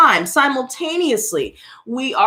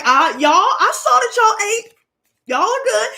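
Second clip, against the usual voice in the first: shooing the cats; 9 semitones higher than usual. Retold or imitated. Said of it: "I'm like".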